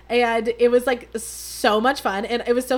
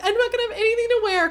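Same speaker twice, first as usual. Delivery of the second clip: mock whiny panic; 11 semitones higher, 3 dB louder, faster than usual. Mocking her own past panic.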